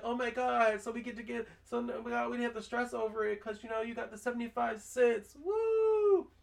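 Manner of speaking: high, happy whoop at the end; excited kid. Retold or imitated. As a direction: celebrate like a relieved kid. Kid celebrating, cheering.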